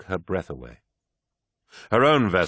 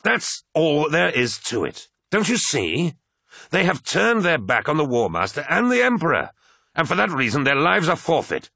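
high-pitched, forceful condemnation; heated indignation; 9 semitones higher, 3 dB louder than usual. Acted. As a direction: hammer home your righteous case. Abaddon arguing heatedly with Loken.

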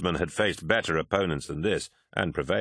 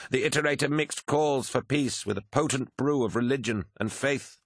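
higher-pitched, deferential officer's report; reporting adviser; 6 semitones higher than usual. Acted. Malachhurst briefs Horus on the fleet speech, higher deferential voice. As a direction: report bad news to a commander, pitched up.

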